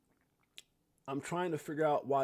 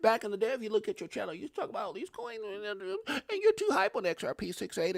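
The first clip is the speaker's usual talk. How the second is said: higher mock voice of a skeptic; mocking doubters; 7 semitones higher than usual. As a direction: lightly parody the critics nagging him. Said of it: "People are like".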